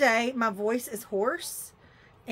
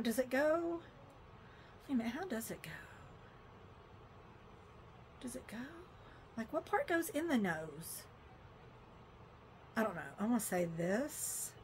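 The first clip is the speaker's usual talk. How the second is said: much softer, absent-minded self-talk; muttering to self; 14 dB quieter, faster than usual. Real, not acted. Vlogger fumbling with a fake nose ring, muttering to herself. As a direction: puzzle it out quietly under your breath.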